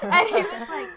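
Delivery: laughing mid-story; giggling while telling it. Real, not acted.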